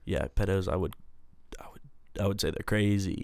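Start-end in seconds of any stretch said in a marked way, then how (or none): 1.6-1.8 unvoiced hushed aside; whispered aside. Real, not acted. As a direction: whisper it like a secret. Aside remark.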